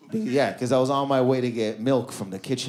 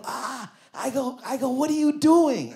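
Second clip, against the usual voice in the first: choked gasp; 9 semitones higher than usual. Retold or imitated. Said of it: "and I can't breathe".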